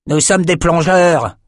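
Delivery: high, loud and slow offended protest; proud indignant retort. A narrator's reading. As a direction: bristle at the insult. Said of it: "s'indigna un des types".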